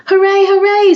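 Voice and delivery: high, loud happy cheer. Acted. Eliza Jane cheering at the trip, loud and high. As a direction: shout hooray like a thrilled kid. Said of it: "said Eliza Jane".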